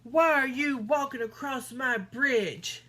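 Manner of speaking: troll yelling. A narrator's reading. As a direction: bellow down at the goat. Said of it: "yelled the hideous".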